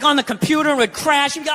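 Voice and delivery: ordinary talk, nothing marked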